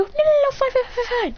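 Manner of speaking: high, rapid stammered giving-in; high-pitched acted panic; flustered backing down. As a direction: squeak out a hasty surrender.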